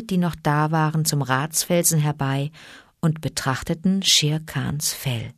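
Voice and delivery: ordinary delivery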